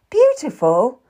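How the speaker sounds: scoffing, disbelieving question; scornful laugh. A narrator's reading. Kat scoffs at Will calling her beautiful, mock scornful. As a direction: throw the compliment back with scorn. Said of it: "I laughed scornfully".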